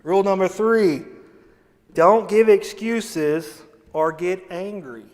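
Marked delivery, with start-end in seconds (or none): none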